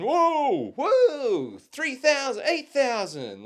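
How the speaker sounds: high, long mock whoops; loud Americans, imitated. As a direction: whoop like rowdy Americans at a punching machine. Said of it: "they were loud and".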